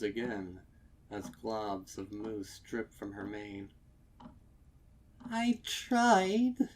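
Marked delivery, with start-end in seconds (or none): 5.2-6.7 high, loud quavering plea; trembling lips. Acted. Mare holding back tears, telling the stallion she tried. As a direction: force it out through trembling lips. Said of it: "she says through trembling lips".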